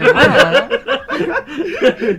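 loud, high burst of laughter. Real, not acted. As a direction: let out a big laugh.

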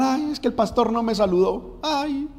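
high-pitched mock complaint; queja imitada. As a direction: imitate a petty excuse-maker. Mimics believers' petty excuses for leaving. (quejita aguda en tono de burla)

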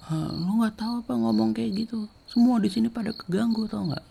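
very high, heated scolding; retold angry outburst. Diti keeps going at the seniors, angry and high-pitched. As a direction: imitate her pressing on in a high, cross voice. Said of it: "tetap ngegas gitu".

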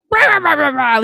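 high, loud gibberish rant; loud cartoonish blah-blah yelling; mock yelling. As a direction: imitate someone ranting in wordless yells. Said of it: "He starts yelling about it".

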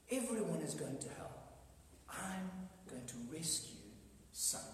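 a hint of a hushed divine verdict; quiet voice of God. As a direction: speak it hushed as God deciding. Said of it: "And God goes".

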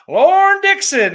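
very high, drawn-out holler; shouted greeting. Real, not acted. Host hailing a viewer in chat, loud high call. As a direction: call the name out big and slow.

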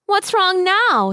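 high, slow fairy voice; sprite voice. A narrator's reading. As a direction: ask it like a sprite. Sprite asks the boy.

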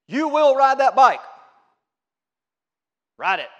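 loud, high bellowed order; forceful shout, no room for argument; stern command. Acted. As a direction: bark it like a drill-sergeant parent. Harsh parent commanding a child.

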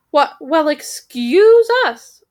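high, loud, slow offended bird voice; a huffy raven, slow and loud; indignant raven. Acted. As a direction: drag out the affront like a huffy bird.

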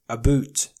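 Canadian "a boot" for about, long oo; Canadian accent. Retold or imitated. Mocking the Canadian accent on "a boot". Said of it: "they will say".

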